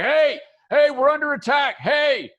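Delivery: high, loud alarm shout; screaming guard. Acted. Game master voices guard warning of attack, screaming. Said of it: "the guard is screaming his head off now".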